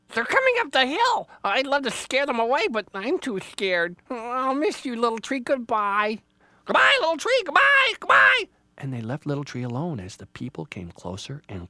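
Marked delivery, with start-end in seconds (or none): 0.1-6.2 high, loud little animal voice; frightened Rabbit. Acted. Rabbit warns, scared, says goodbye. 6.6-8.4 very high, loud squawk; screeching bird farewell. Acted.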